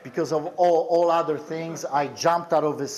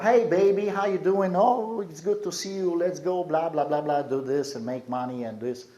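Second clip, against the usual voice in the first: flirty street hustler; 5 semitones higher, 3 dB louder than usual. Retold or imitated. Prostitute greets him on the street.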